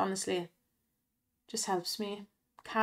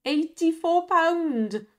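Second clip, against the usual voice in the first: high, slow amazed announcement; excited; 7 semitones higher, 4 dB louder, much slower than usual. Real, not acted. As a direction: stretch it out in delight. Big slot win shown.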